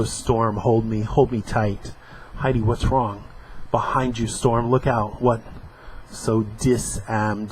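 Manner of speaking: comic panels read aloud with voices; acting out a comic strip; dramatic reading.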